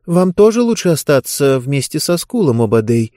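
usual speaking voice

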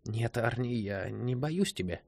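soft, slow, lightly husky woman's voice; slightly husky. A narrator's reading. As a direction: answer him softly with a touch of huskiness. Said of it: "ответила хрипловатым голосом".

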